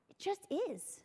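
softly echoed, almost under breath; soft, quiet repeat. Retold or imitated. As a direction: let it drop to a hush. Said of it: "And he's like".

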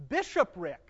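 bishopric spoken out clearly, each syllable shown; say the word. Shown as a demonstration. Teacher to class reader, modelling bishopric clearly. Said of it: "say that word".

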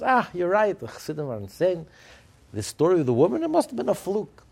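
loud, high mock agreement; emphatic agreement. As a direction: declare it loudly, sure of yourself. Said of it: "So Rameir Fall says".

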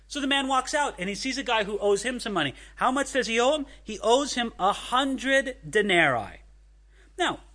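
normal talking voice

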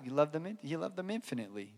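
very soft, low, conceding answer; sheepish reply. Retold or imitated. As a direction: shrink the voice down as you admit it to God. Sheepish answer to God's challenge.

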